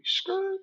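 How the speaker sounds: high squealing tire screech from the mouth; tire screech imitation. Teller imitating a car pulling up with a high screech. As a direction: mimic a car skidding to a stop.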